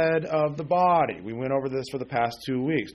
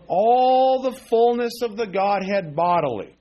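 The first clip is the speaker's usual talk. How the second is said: forceful scripture proclamation; 6 semitones higher, 7 dB louder, slower than usual. Real, not acted. Preacher reading scripture climax.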